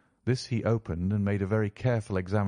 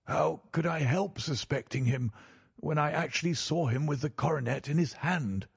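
higher-pitched banker defending his suspicion; indignant banker; 7 semitones higher than usual. A narrator's reading. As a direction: play an insistent, aggrieved banker.

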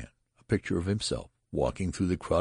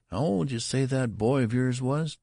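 high-pitched, inquisitive clerk; curious demand; 8 semitones higher, 4 dB louder than usual. A narrator's reading. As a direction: ask with raised, curious voice. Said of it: "demanded the clerk curiously".

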